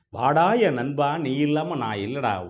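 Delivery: slow, drawn-out welcome to a friend; warm embrace. Acted. God gathers up Satan as his friend, slow and affectionate. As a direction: draw out the words affectionately. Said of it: "அப்படியே வாரி அணைச்சுக்கிட்டு".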